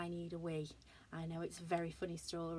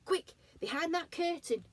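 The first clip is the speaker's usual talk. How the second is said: urgent shout; 10 semitones higher, 6 dB louder than usual. Acted. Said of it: "shouted Robot".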